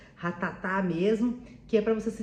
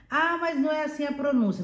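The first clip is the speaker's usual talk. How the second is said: higher-pitched mock objection; lightly imitated worried learner; 7 semitones higher than usual. Learner objecting about pronunciation, lightly mocked worried voice. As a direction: lightly mimic someone fretting.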